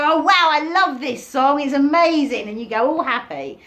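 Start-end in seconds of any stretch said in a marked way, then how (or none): 0.0-2.5 much higher and louder, gushing with delight; thrilled fan. Retold or imitated. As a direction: gush like you just heard your favourite song.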